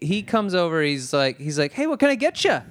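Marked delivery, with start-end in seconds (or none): 1.7-2.6 high, chirpy mock server; happy and peppy. Imitating sandwich guy greeting a customer, happy and peppy. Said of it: "All happy and peppy".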